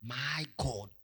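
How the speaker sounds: soft, stunned gasp of alarm; alarmed exclamation. Acted. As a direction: say it softly, taken aback. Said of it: "in alarm and said".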